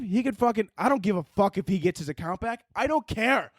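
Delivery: ordinary talk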